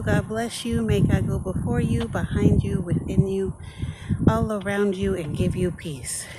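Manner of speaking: gentle spoken benediction, lifted a little; blessing. Acted. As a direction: bless them like a pastor at the door.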